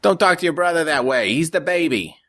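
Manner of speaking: mother telling off her daughter; scolding mom. A narrator's reading. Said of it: "their mom yelled".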